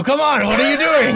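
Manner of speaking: a mock salty sea dog hurrying his mate; pirate voice. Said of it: "this stupid voice".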